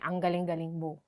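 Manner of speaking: understated mock compliment; sarcastic. Shown as a demonstration. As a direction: say it like you don't mean it. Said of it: "you want to be sarcastic".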